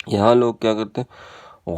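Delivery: ordinary talk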